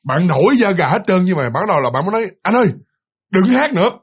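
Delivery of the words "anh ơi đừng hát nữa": higher-pitched retold scolding; retold snap. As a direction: mildly snap at a partner to stop. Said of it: "bạn kiểu như bạn nạt lên luôn".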